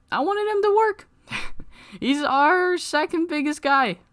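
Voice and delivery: very high, far-off goofy voice, slowing into a laugh; comic falsetto whine that breaks into laughter; squeaky mock falsetto. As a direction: squeal it like a whiny kid, giggling through. Joking about wanting wrestler to work.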